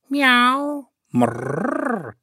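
high mew, then rolled purr; cat meow and purr. Acted. Cat calls out on arriving, meowing and purring.

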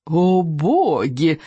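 long drawn-out, relishing exclamation; utter delight. A narrator's reading. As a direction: savour the thrill, stretching each word. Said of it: "улыбнулась Камикадзе в полном восторге". (протяжный восторг)

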